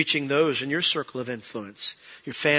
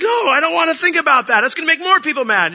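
much higher, louder whiny no; whiny refusal; 10 semitones higher, 6 dB louder than usual. Retold or imitated. Preacher refusing God's nudge, high and whiny. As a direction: refuse like a sulky kid. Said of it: "I was like".

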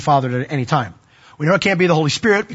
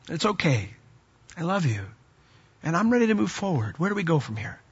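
gentle reassurance; 8 dB quieter than usual. Retold or imitated. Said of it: "I go to people all the time and I say".

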